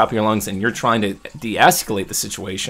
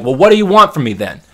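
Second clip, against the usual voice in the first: higher and louder, fed-up outburst; exasperated yell; 6 semitones higher, 8 dB louder than usual. Retold or imitated. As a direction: throw it back at them. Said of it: "you yell at them one time".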